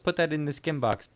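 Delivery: lightly voiced Dutch villain; Goldmember impression. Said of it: "Goldmember is like".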